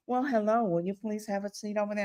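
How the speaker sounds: a soft, sweet hint of a teacher asking nicely; lightly voiced gentle teacher; teacher politely asking a child to sit. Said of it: "the teacher says".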